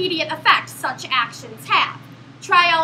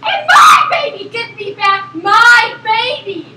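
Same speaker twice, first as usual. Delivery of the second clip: panicked mother scream; 6 semitones higher, 9 dB louder, slower than usual. Acted. Frantic mother demands baby.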